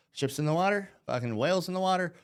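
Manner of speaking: ordinary delivery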